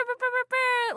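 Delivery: high, loud lip-trill brr; trilled brr sound. Acted. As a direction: roll the lips in a brr. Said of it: "I've started episodes with brr".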